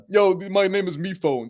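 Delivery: put-on cartoon character voice; MePhone voice. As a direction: introduce yourself in a character voice. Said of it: "this is the me phone voice".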